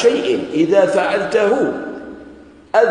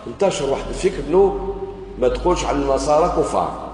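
ordinary talk, nothing marked

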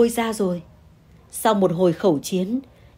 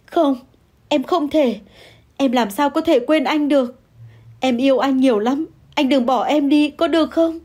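wailing plea; 6 semitones higher than usual. Acted. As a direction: cry out, begging him not to leave. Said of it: "Hà gào lên". (gào khóc van xin, giọng cao và to)

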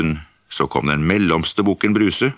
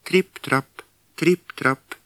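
light tripping steps; 8 semitones higher, slower than usual. Acted. Little goat crossing the bridge.

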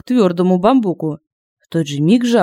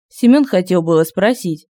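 ordinary delivery

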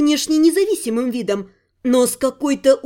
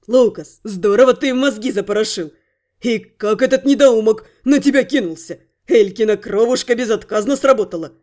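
lower, lively voice of a cheeky thief; approving thief voice; 4 semitones lower than usual. Acted. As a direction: praise him like a pleased rogue. Thief Rent praising, voiced character.

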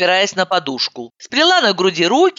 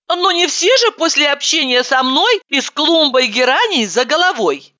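put-on high girlish voice; 10 semitones higher than usual. Heroine protests, high.

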